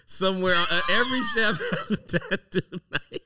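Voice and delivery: loud, high laughing tease. Real, not acted. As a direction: crack up while teasing. Teasing with laughter.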